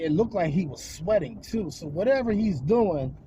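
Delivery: ordinary delivery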